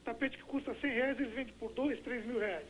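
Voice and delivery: normal talking voice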